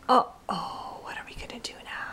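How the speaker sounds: hushed mock-worried whisper; playful whisper. Mom whispering to her baby, playfully hushed.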